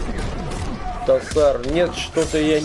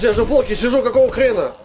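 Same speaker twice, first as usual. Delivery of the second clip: ordinary talk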